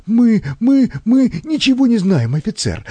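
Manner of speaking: high, stammering panic; nervous shock. A narrator's reading. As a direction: plead innocence in a high, rattled voice. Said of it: "стал проявлять симптомы глубокого нервного потрясения".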